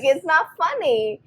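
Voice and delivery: put-on a mom scolding a giggling child; stern mom. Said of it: "I'm like".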